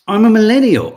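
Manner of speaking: loud, high, mock-proud announcement; proud declaration. Host trumpets being a millennial. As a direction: declare it grandly.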